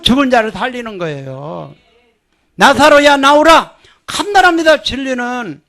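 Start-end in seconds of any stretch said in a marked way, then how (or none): 2.6-3.8 high, loud proclamation; ringing call to Lazarus; loud command. Acted. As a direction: command the dead to come out. Preacher proclaims Jesus' command.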